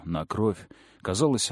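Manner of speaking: ordinary delivery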